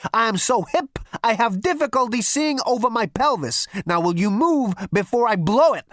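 loud, cocky and impatient; brash Zaphod. Acted. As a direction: throw your weight around. Zaphod boasting loudly.

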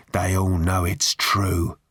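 slow, dark aside; darkly. A narrator's reading. Rob McKenna leaning forward to confide in Arthur, darkly. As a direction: draw it out darkly, as a grim confidence. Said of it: "he added darkly".

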